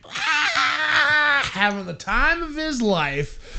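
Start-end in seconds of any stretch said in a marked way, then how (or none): 0.1-1.5 high, drawn-out mock scream; imitated excited scream. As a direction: imitate a thrilled kid screaming. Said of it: "just screaming".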